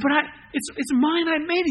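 put-on a small boy begging for fairness; pleading little boy. Boy to store owner, high pleading protest.

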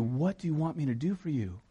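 soft, tender offer of help; Jesus. Acted. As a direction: ask quietly, with an open heart. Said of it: "That's his heart".

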